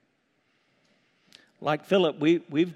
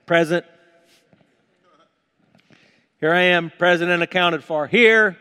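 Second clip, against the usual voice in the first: loud, higher roll-call answer; 4 semitones higher, 11 dB louder than usual. Pretend. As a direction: answer a roll call so the back row hears.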